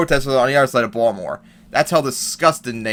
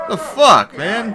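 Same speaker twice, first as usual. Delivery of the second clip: shocked outburst; 9 semitones higher, much slower than usual. Real, not acted. Vlogger watching a reporter get hit, shocked shout. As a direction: blurt it out in shock. Reacting to reporter being attacked.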